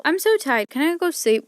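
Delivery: whiny complaint. Real, not acted. Worn-out narrator begging to stop recording, whiny and loud.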